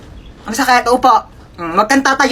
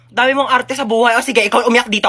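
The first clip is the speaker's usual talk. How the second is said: ordinary delivery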